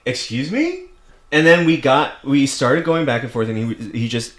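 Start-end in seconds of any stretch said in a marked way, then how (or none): 0.1-0.8 indignant. Retold or imitated. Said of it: "I was like".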